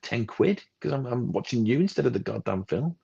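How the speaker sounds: high-pitched exasperated outburst, loud at first then easing; indignant rant. Retold or imitated. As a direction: re-enact scolding a cinemagoer. Acted telling-off of phone user.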